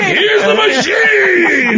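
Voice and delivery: very high, very drawn-out mock shout; imitated ecstatic yell; delighted cheer. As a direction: stretch it out in a high holler. Igor's retold cry.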